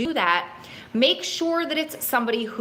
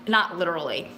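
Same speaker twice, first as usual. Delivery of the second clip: usual speaking voice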